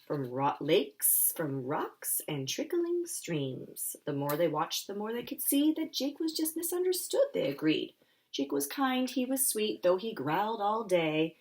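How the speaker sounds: put-on lively picture-book reading for kids; animated storytelling voice; story narration. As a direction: read it like story time for little ones.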